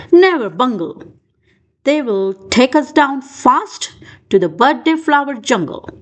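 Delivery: usual speaking voice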